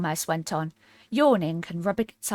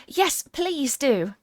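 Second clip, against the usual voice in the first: higher, very slow begging; pleading; 8 semitones higher, much slower than usual. A narrator's reading. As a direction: plead sweetly, drawing it out. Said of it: "pleaded Alice".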